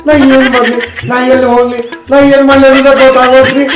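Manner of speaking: high-pitched, hammed-up crying complaint; tearful wailing. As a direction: sob out your misery to the lawyer. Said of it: "ನೀ ಅಳ್ಬೇಡ".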